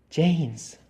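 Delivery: a mournful, distant call of the name; sad ghostly voice. A narrator's reading. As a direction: call him like a sorrowful ghost. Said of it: "aquella voz del colegio de Oxford, pero muy triste". (voz triste y lejana)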